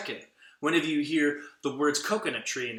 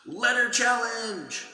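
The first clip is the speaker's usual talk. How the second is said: higher, louder, slow announcement; game show call; 8 semitones higher, 8 dB louder, slower than usual. Shown as a demonstration. Puppet announces the letter challenge, big and loud. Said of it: "when you hear me say".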